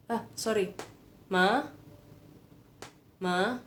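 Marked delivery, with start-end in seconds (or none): 1.3-3.6 ma rising like calling mom; ma with a rising call, as if calling mama; second tone demo. Shown as a demonstration. Teacher showing students the second tone, rising call. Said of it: "kayak orang waktu mau manggil mama".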